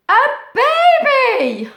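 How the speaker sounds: high, loud, slow exclamation; delighted reveal. A narrator's reading. Storyteller to children, high loud delighted reveal. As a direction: announce it like a wonderful surprise.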